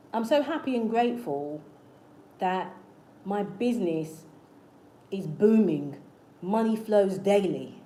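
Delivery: slow, deliberate affirmation with pauses between phrases; affirmation demo. Shown as a demonstration. Coach demonstrating an affirmation for your business, slow and deliberate. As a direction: say it phrase by phrase, firmly and slowly. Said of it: "I'm gonna give you an example of an affirmation".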